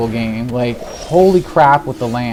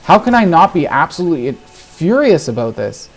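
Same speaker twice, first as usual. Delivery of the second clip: normal talking voice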